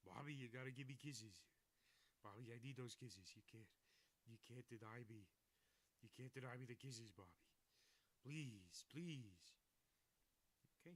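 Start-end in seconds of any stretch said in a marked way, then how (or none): 0.1-9.6 pleading cutesy pet voice; baby voice to pet. Acted. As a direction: beg the pet for kisses in a sing-song.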